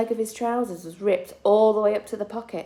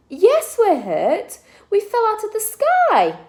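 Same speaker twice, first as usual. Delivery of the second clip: put-on upset child; 14 semitones higher, 8 dB louder, slower than usual. Child answers from bush.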